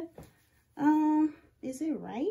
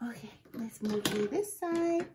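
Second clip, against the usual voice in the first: a focused whisper. Real, not acted. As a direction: whisper it while concentrating hard. Concentrating on cutting.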